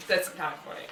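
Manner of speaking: normal talking voice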